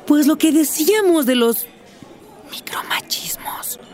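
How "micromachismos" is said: slow, very soft whisper; confidential whisper. Acted. Woman reminding husband of their earlier talk, confidential whisper. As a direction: whisper the word slowly, as if it were secret. Sensitive word in a restaurant.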